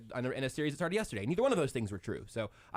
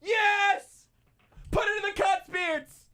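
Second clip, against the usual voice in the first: much higher, much louder joyful "Yes!"; excited shout; 5 dB louder, much slower than usual. Real, not acted. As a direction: yell it out in triumph. Thrilled at hearing the praise.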